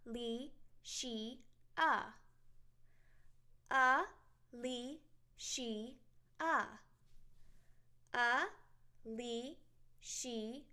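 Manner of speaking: Alicia stretched out slowly, one beat per syllable. Shown as a demonstration. Teacher slowly breaking her name into syllables. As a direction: draw out Alicia slowly, clapping each syllable. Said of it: "I have four syllables in my name".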